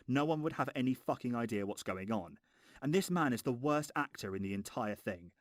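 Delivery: ordinary talk, nothing marked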